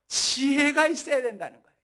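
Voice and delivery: loud, forceful pulpit delivery; emphatic preaching. Real, not acted. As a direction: drive the point home loudly.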